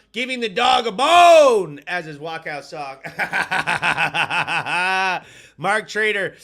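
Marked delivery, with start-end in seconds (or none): 0.1-1.8 shouting. Retold or imitated. Reading a funny comment. 3.0-5.5 loud laugh. Real, not acted. Laughing at the comment.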